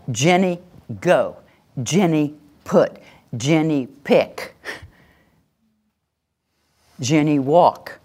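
slow, firm name and one verb; name then verb. Shown as a demonstration. Parent giving a child a firm two-word command. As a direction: give the command in two clipped beats. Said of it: "It was name, verb".

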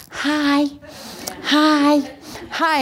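put-on high, eager little-kid hi's; toddler greeting. Two-year-old at the airport greeting strangers, high and eager.

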